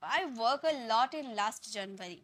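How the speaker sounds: slow, careful reading of the faulty sentence; slow example sentence. Shown as a demonstration. Teacher reads the wrong sentence aloud to students. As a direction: read the error out slowly. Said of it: "अब हमारा क्या mistake है".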